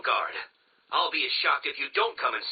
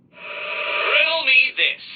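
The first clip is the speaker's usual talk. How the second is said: slow, high and loud, savouring each word; grand villain's flourish, drawn out; theatrical riddle intro; 8 semitones higher, 5 dB louder, much slower than usual. Acted. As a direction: announce the riddle like a showman.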